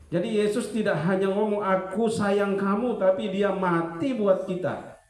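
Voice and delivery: slow, high, loud preaching; slow emphatic sermon proclamation. Real, not acted. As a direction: drag each word out for weight.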